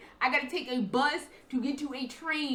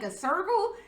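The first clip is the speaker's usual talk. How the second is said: normal talking voice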